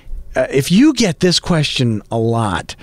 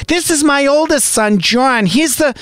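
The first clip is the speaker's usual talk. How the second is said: much higher, sing-song proud mother voice; mom impression; 12 semitones higher than usual. Mom introducing her son to people, in a high, proud mock voice. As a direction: ham up a doting mother showing off her son. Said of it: "she doesn't really talk like this".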